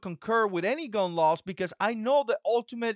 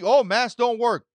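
louder, dismissive mock quote; mock CDC; 7 dB louder than usual. Mocking the CDC's first claim, loud and dismissive. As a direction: announce it like an official.

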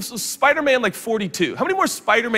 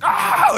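mock superhero cry; 12 semitones higher than usual. Acting Spider-Man shooting webs.

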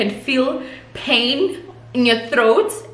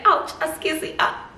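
high yelp of ouch; mock pain; 8 semitones higher, 3 dB quieter than usual. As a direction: squeal as the hair is pulled, higher. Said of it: "I'm busy like".

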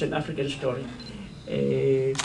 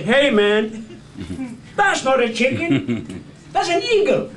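knowing man; 5 semitones higher, 4 dB louder than usual. Acted. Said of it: "somebody smart ... said".

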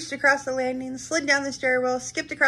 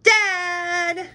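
high call across the house; 8 semitones higher, 3 dB louder than usual. A narrator's reading. Splat calls Dad to come help with chores. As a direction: call for Dad from another room.

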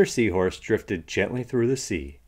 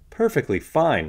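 chipper reply; 8 semitones higher, 6 dB louder than usual. Acted. Mister Curtis answers the greeting.

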